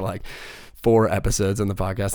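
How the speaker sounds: ordinary talk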